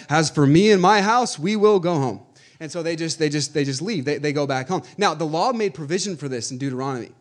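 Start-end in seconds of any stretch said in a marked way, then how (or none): 0.1-2.2 mock solemn declaration. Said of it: "They're like".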